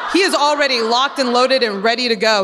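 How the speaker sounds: ordinary talk, nothing marked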